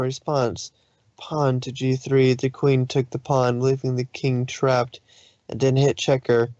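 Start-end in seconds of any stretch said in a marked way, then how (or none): none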